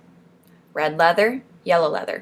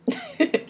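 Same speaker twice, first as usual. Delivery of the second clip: short high-pitched giggle; amused little laugh; 6 dB quieter than usual. Real, not acted. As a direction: let out a quick, soft giggle. Amused at her own remark.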